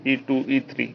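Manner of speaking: ordinary delivery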